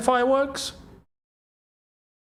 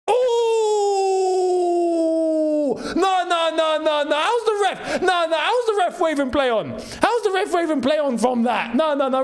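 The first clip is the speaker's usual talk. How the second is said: outraged shout; 9 semitones higher than usual. Real, not acted. Streamer outraged at ref waving play on. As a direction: protest the call in disbelief.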